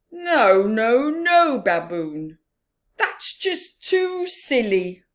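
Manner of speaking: loud, high protest, slow at the end; high, loud fed-up Rhino; exasperated Rhino. Acted. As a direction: groan it's too silly. Rhino rejects silly horns.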